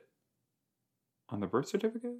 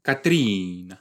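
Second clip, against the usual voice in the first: ordinary talk, nothing marked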